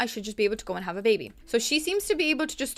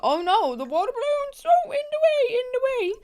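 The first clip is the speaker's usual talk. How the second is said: much higher, squeaky mock whine; mock whiny squeak; 5 dB louder than usual. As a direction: whine it like a petulant cartoon. Frustrated mocking of the game.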